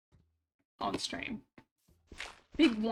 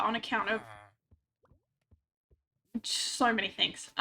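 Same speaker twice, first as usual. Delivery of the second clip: ordinary talk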